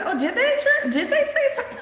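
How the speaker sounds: amused startled question; surprised high laugh. Real, not acted. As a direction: squeak it out, giggling at the news. Surprised at chat news.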